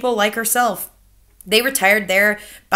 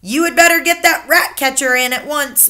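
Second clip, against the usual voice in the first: higher, louder grandmother voice; accusing grandmother; 6 semitones higher, 7 dB louder than usual. Acted. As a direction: pin the blame on him. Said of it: "my grandmother said".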